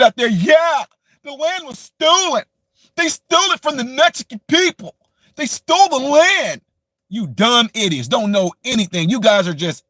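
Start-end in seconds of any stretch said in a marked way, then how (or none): 0.3-6.6 mock woke youngster. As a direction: squeal it like a preachy kid to ridicule them. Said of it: "the younger generation who don't know their history".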